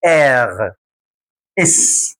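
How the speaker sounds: ordinary talk, nothing marked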